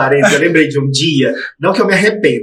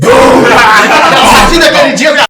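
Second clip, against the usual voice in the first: slow, loud, high theatrical declaration; over-the-top dramatic proclamation; mock dramatic; 6 semitones higher, 5 dB louder, slower than usual. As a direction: announce it like a life-changing testimony. Mock dramatic life-change announcement.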